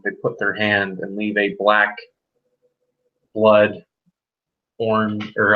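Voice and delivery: usual speaking voice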